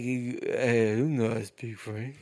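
slow mock French kid's bad accent; horrible movie accent. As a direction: drag it out in an awful, mangled accent. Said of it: "just fucking horrible accent".

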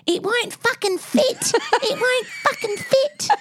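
put-on very high, slow little-kid voice; five-year-old girl. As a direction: imitate a small child struggling and swearing. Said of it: "And she goes".

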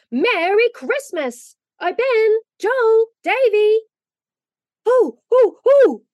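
high, loud, drawn-out holler; calling out. A narrator's reading. Polly calls up the stairs to wake the boys, hollering. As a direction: call each name out long and high. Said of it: "go to the foot of the stairs and call".

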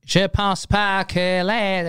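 high, drawn-out little voice. Retold or imitated. Host imitating the comedian on stage, little voice. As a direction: stretch it out slowly in a small high voice. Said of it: "avec sa petite voix".

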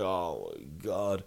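slow, low, soft retold groan of dismay; weary despair. Tired passenger learns bag is elsewhere, retold deflation. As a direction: drag the words out, defeated.

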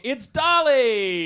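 high, loud, drawn-out announcer's call; showy guest introduction. Real, not acted. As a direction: announce the star guest with a big rising shout. Host introduces a guest on stage.

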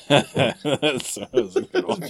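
words through real laughter; laughing. Real, not acted. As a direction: chuckle while praising the joke. Laughs at the pun.

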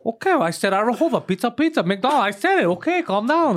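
defensive friend, hammed up; mock defensive. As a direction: brush off the complaint. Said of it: "He's like".

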